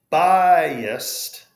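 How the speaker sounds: "biased" said high and loud as one glide; model word. Shown as a demonstration. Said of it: "really should be a two-syllable word".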